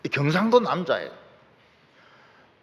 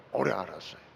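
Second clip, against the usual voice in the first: 오래 알았어요 repeated much lower and softer; quiet lower repeat of 오래 알았어요; soft echo; 11 semitones lower, 11 dB quieter, faster than usual. Shown as a demonstration. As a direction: let 오래 알았어요 fall away to a murmur.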